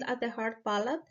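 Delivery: ordinary talk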